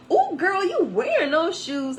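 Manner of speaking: put-on high, loud, slow sassy "ooh girl"; lady in the parking lot gushing over heels; sassy compliment. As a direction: call out the shoes like an excited stranger. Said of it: "she goes".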